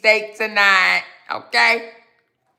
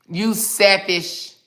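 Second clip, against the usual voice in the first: slow drawn-out scold; much slower than usual. Acted. Scolding the woman in a comment.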